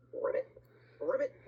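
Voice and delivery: punchy, loud ribbits; two short frog ribbits; frog croak. A narrator's reading.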